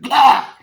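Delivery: very loud, high burst; mock demon shout. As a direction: blurt out a sudden monster cry. Imitates demon at trapdoor.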